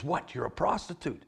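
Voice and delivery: high, incredulous question; astonished, pitched well up; shocked disbelief. Acted. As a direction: react as if stunned by the news. Shocked at learning her trade.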